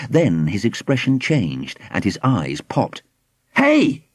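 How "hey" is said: high-pitched startled call; suddenly altered cry. A narrator's reading. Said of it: "he cried in a suddenly altered voice".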